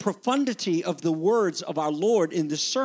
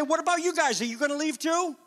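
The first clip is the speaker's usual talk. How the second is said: searching question; 6 semitones higher than usual. Retold or imitated. Jesus asking the twelve.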